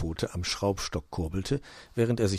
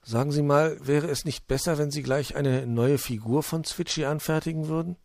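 higher, lilting storybook character; a politely suggesting voice, pitched up; Puh, polite; 7 semitones higher than usual. A narrator's reading. Puh suggesting a new figure, politely high-voiced.

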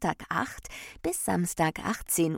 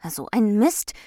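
loud, higher-pitched grumble; annoyed outburst; 4 semitones higher, 9 dB louder than usual. A narrator's reading. Ordnung finds everything in a muddle, annoyed. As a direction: exclaim it, cross at the mess.

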